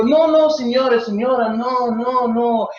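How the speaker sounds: hammed-up Italian scientist protesting; Italian accent. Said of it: "then the Italians go".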